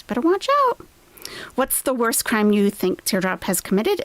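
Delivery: ordinary talk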